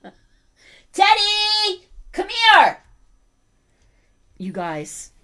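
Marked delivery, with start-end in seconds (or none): 0.9-2.8 loud, high, drawn-out call; calling the dog. Real, not acted. Owner summons Teddy to the stream, calling out. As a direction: holler high for the dog to come.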